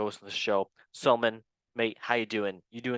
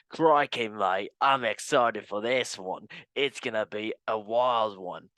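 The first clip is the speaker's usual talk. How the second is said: mock Australian accent; slower than usual. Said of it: "Can I do any more Australian".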